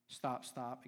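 usual speaking voice

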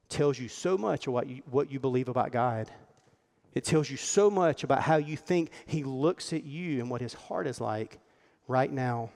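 ordinary talk

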